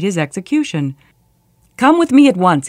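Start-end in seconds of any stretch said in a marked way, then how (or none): 1.8-2.7 high, loud urgent command; ordering. A narrator's reading. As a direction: order him along firmly and urgently. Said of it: "he ordered".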